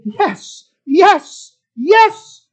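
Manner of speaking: rising to loud, high exclamations; emphatically cheerful, growing bigger; emphatic, cheerful. Acted. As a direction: punch out a joyful yes, building each time. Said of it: "It is meaning emphatically and cheerfully".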